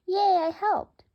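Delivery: high, loud squeaky bird voice; bird's voice. Pretend. Narrator voices the bird cheering, high loud pet voice. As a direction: cheer as a proud little bird.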